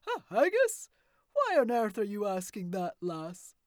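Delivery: put-on loud, booming old man's voice; a wild old woodsman challenging strangers; gruff old hermit. Old man questioning the girl and granny in his woods, loud and gruff.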